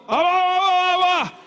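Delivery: put-on high-pitched happy baby cry; imitated toddler babble. Grandfather imitating his toddler grandson's high excited babble. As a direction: mimic a toddler's excited wordless call. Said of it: "he'll go".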